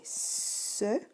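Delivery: "ce" stretched out slowly as sss-e; slow, drawn-out "ce" on its own; isolated syllable. Shown as a demonstration. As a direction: sound out "ce" as one long syllable. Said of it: "la première syllabe est".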